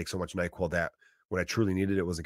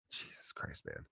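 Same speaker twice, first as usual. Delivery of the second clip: ordinary talk, nothing marked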